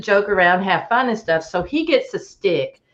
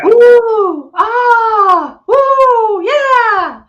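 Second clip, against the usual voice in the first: high, loud hooting howls with a cry in them; mock Bigfoot calls; 14 semitones higher, 7 dB louder, much slower than usual. Telling friends about the calls from the sky, high hooting howls. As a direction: howl it out like a creature calling across the woods.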